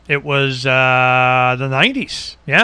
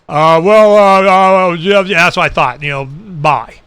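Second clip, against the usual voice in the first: hesitant, stumbling, louder uh; flustered officer; 4 dB louder, slower than usual. Retold or imitated. Internal Affairs officer caught off guard, flustered. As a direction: fumble for words.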